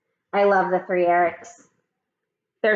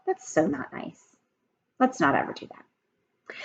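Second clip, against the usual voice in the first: softer, hushed aside falling to a whisper; 7 dB quieter than usual. Real, not acted. Teacher telling kids not to lump people together, hushed whisper. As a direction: confide the lesson gently.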